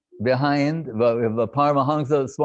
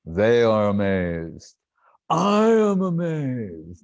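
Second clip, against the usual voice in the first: imitated amazement; slower than usual. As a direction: savor each word with wide-eyed wonder. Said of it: "His eyes got very big".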